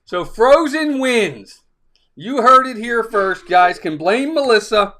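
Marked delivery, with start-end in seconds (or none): 0.0-1.6 slow declaration. Real, not acted. Declaring winner.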